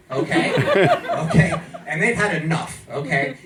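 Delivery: normal talking voice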